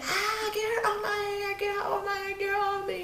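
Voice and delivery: put-on high singsong wail, mocking her crying; mock screaming. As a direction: mimic a helpless scream without the volume. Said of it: "She's screaming".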